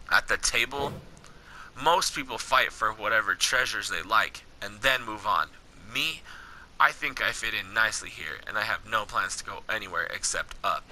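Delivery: low, measured game-dialogue read; story dialogue reading. Acted. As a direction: read the wasteland encounter steadily, below your chat pitch. Reads Corbin and wanderer dialogue.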